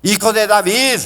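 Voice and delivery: high, loud desperate call; pleading shout for help; desperate cry. Retold or imitated. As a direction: cry out to Jesus across the crowd. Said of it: "y le grita".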